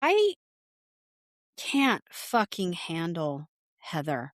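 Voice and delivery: slow, heavily spaced exasperation; real fed-up emphasis with long pauses; exasperated venting. Real, not acted. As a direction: draw out each word, utterly fed up. Venting frustration about Heather.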